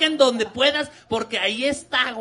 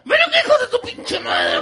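high, louder furious summons; furious mom; 12 semitones higher, 5 dB louder than usual. Retold or imitated. Mother summoning her son when she is furious, high loud call. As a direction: call the kid over like an enraged mother. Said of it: "estaba muy encabronada".

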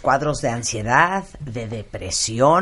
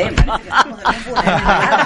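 laughing; 6 semitones higher, 4 dB louder than usual. Real, not acted. Host laughing at the situation.

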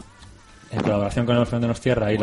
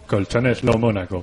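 radio announcer voice; slower than usual. Shown as a demonstration. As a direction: announce it like a late night radio DJ. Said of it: "tengo esta voz de locutor de radio".

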